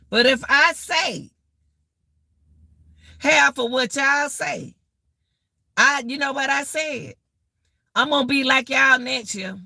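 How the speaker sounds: ordinary talk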